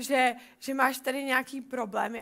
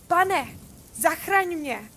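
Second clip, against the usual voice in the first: higher, louder desperate cry; cry for help; 3 semitones higher, 6 dB louder, much slower than usual. Acted. Peter sinking in the waves calls to Jesus, desperate cry. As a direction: shout it as a man going under. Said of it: "vykřikl".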